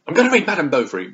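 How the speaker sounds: high, loud, fast burst; mock grand announcement. Reader acting out her decision to read, mock excited. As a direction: blurt it out with exaggerated zeal.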